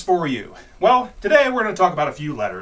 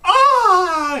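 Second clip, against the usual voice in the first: high, loud mock cry; high shriek; 7 dB louder than usual. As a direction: let out a high yelping cry. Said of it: "Then she goes".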